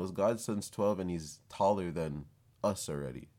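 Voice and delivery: usual speaking voice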